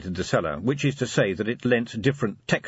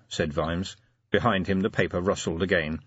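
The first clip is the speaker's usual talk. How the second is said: ordinary delivery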